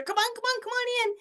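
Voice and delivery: high-pitched coaxing call to the puppy; calling the dog in, way up high and eager; high, excited calling from the doorway. Retold or imitated. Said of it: "Oh no, I gotta lower my energy".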